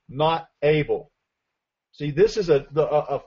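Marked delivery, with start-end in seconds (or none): 0.1-1.1 "not able." slow and deliberate, falling on not; stress on not able. Shown as a demonstration. Preacher urging the congregation to underline it, stressed. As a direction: lean hard on "not able.". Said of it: "I want you to underline that word".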